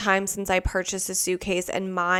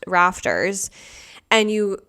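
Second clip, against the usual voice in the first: normal talking voice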